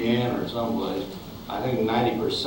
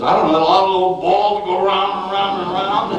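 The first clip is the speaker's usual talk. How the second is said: clueless Carroll; 8 semitones higher, 7 dB louder than usual. Retold or imitated. Clueless pilot quote, Carroll voiced.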